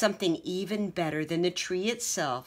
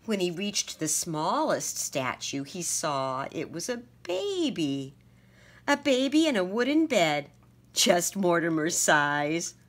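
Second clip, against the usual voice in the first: plain reading voice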